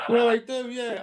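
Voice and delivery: loud laugh through the words; agreeing while cracking up. Real, not acted. Laughing at joke about free time.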